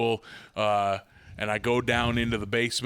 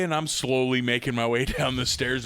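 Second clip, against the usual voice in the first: usual speaking voice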